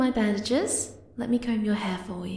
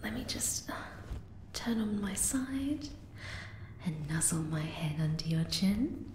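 intimate whisper; 6 semitones lower, 7 dB quieter than usual. Acted. Snuggling close before sleep.

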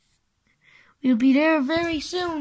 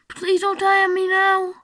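normal talking voice

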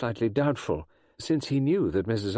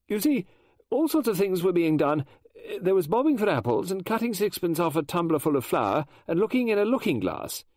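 higher, louder flustered lady novelist; Mrs Oliver voice; 9 semitones higher, 3 dB louder than usual. Acted. Mrs Oliver explaining schools to Poirot, high and eager. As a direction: chatter on like an excitable Englishwoman.

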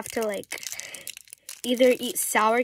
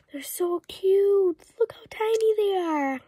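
gushing; 8 semitones higher than usual. Real, not acted. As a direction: coo over something adorable. Gushing over tiny gummies.

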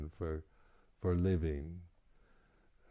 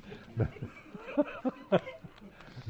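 breathy laugh; 10 semitones higher than usual. Real, not acted. Laughing at retreat confusion.

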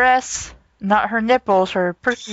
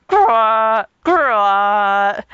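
growl; 4 dB louder than usual. Acted. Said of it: "a raspy howl came from the closet".

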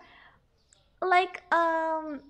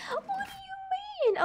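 very high squealed protest; mock-indignant whine; 13 semitones higher than usual. Real, not acted. Self-declared professional questioned, squealing in mock disbelief. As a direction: squeal it in playful disbelief, fresh from laughing. Repeats protest after laughing.

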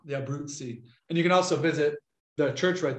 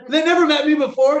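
high, loud burst of disbelief; incredulous; 13 semitones higher, 8 dB louder than usual. Real, not acted. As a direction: exclaim it, much higher and louder. Incredulous retelling to laughing listeners.

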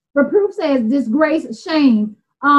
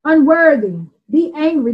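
ordinary delivery

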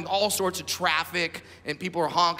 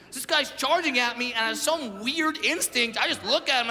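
ordinary delivery

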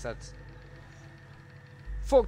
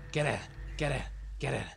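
ordinary talk

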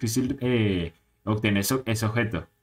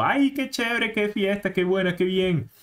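much higher, squealing with party joy; excited cheer; 7 semitones higher than usual. Acted. Pokémon celebrating the record with confetti, high gleeful cheer. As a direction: squeal it like the confetti just fell.

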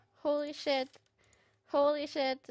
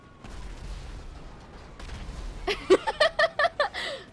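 high unvoiced giggling burst; high laugh; 11 semitones higher than usual. Real, not acted. Streamer laughing at the carnage in the game, high airy giggle.

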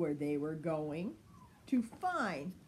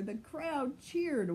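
plain reading voice

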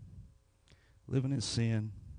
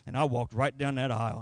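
ordinary delivery

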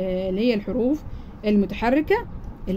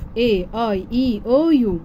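A I E O U said slowly, one letter at a time; slow spelling out; much slower than usual. Shown as a demonstration. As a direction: lay out each vowel slowly for the class. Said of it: "زي ما قلنا الحروف المتحركة". (نطق الحروف المتحركة ببطء)

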